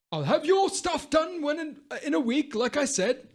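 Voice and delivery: put-on high, thin old man's voice, far from his own; blind old father. Retelling a film, voicing the blind old father high. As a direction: play the frail blind dad talking to a customer.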